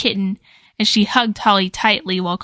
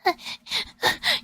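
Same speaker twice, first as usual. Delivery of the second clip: nervous breathy laugh; 7 dB quieter than usual. Acted. Nervous laugh after escaping.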